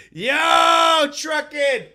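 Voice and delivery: drawn-out shout. Real, not acted. Calling out to a viewer.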